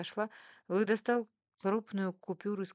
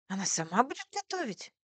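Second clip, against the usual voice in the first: high, louder astonished question; surprised; 5 semitones higher, 5 dB louder than usual. A narrator's reading. Waitress asks if madame cooks herself, surprised. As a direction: let the pitch jump in disbelief. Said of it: "удивилась девушка".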